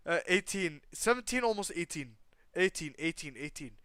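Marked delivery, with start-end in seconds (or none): none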